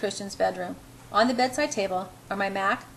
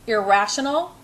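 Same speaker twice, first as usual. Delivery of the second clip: louder, indignant echo; mild growl of disbelief; growled question; 5 dB louder than usual. A narrator's reading. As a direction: throw the word back as a growl. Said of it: "he growls".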